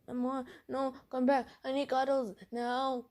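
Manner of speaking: high, whiny mock pleading; needy boyfriend. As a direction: imitate a clingy boyfriend begging. Boyfriend begs her not to go.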